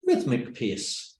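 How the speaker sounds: slow, evenly spaced words; measured rhythmic pace. Shown as a demonstration. Teacher showing the pace one learned for conversations, slow and measured. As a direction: space each word out deliberately. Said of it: "delivered them in a normal rhythmic pace".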